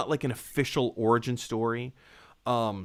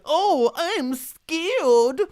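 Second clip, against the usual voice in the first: high, drawn-out mock whimper; whiny scared Flash; 14 semitones higher, 5 dB louder, much slower than usual. Streamer mocking the Flash as a whiny coward, high. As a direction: whine it like a frightened kid. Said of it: "He was the whiny character".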